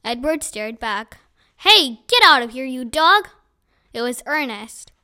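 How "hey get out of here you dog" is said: loud, angry yell at the dog; shouting. Acted. Ernest yells at the dog.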